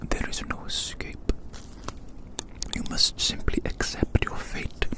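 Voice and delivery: ordinary talk, nothing marked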